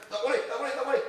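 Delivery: high, loud, repeated forbidding; mock strict refusal. Imitating officials blocking others' ideas, mock strict refusal. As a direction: veto it like a rigid official.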